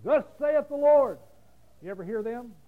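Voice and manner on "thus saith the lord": higher, louder, slow "Thus saith the Lord"; mock prophet proclamation. Preacher mocking Old Testament prophets, higher and loud. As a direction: ham up a thundering old prophet. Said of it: "Old Testament prophet who runs around saying".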